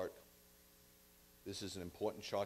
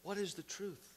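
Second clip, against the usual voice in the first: dismissive question; 6 semitones higher, 10 dB quieter than usual. Acted. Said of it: "Pilate asked him".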